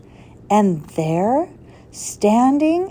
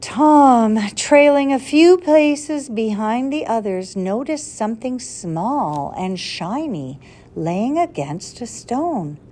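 usual speaking voice

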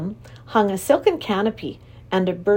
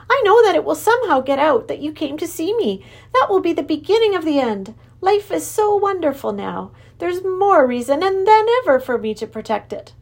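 high, anxious creature king; worried Wangdoodle king; 8 semitones higher, 4 dB louder than usual. Acted. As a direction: plead to keep his happy life. King fears losing happiness.

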